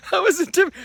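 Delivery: hammed-up high whine of a timid witness; whiny mock Comey. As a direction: squeal it like a scared kid. Mocking Comey again, whiny.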